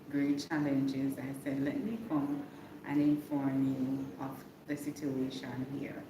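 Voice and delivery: normal talking voice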